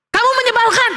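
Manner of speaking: put-on high, cross child's shout; annoyed yell. A narrator's reading. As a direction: yell it at someone far away. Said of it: "Lalu dia teriak dan dia mengatakan".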